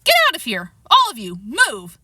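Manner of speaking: loud, forceful dismissal; angry order. Acted. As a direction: order everyone out sharply. Threatened intruders are ordered out.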